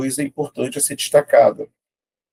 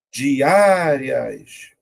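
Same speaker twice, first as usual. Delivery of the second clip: "Diárias" drawn out, Á held long; syllable by syllable. Shown as a demonstration. Teacher testing an option for stress, syllable by syllable. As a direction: stretch the Á in "Diárias". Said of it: "Vou separar".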